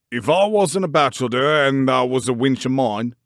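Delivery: loud, gruff Yorkshire scolding; indignant old man bellowing over a wall; angry old gardener. A narrator's reading. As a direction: shake your fist as you shout. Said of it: "he cried".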